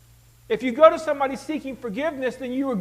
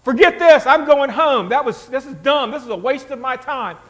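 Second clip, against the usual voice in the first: loud, acted huff, easing at the end; huffy and mad; 9 dB louder than usual. Naaman giving up and going home, huffy and mad. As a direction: storm off in a mock temper. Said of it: "Naaman gets all huffy and mad".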